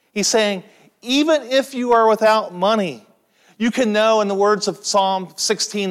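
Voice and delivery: loud, high-pitched fervent preaching; preaching at full force. Acted. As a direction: press the promise home to the congregation. Preacher drives home the point.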